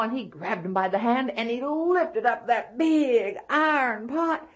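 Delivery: plain reading voice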